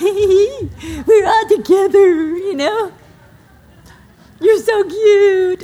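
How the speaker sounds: high, loud, giggly gushing; giddy awkward mom. Retold or imitated. Mother gushing over her grown daughters, giggly and high. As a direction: gush like an overexcited awkward friend. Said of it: "I act stupid, like the awkward friend".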